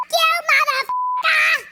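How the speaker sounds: high, strained mock yell; mock shout. Mom repeating boyfriend's phone fight, mock yelled. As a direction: blurt the curse as a yelled quote.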